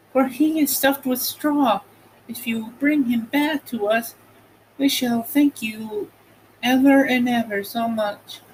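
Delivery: put-on high girlish voice introducing her friends; Dorothy's voice. A narrator's reading. Dorothy introducing her friends to the stork, high girl voice.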